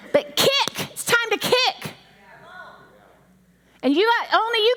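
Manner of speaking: high, insistent urging; urgent exhortation. Retold or imitated. Preacher urging listeners into the last kick, high exhortation. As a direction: pitch it high like a sideline cheer.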